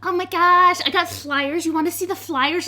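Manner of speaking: high, loud, gushing; bubbly high girlish voice; excited tiefling girl. Acted. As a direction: burst out with eager delight. Excited tiefling girl offering flyers.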